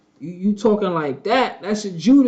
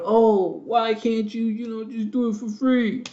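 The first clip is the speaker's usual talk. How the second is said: mocking imitation; 4 semitones higher than usual. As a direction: mimic a wheedling freeloader in a raised voice. Mimics those demanding free work.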